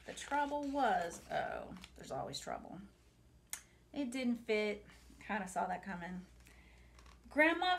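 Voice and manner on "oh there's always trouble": dry aside. Real, not acted. Aside comment.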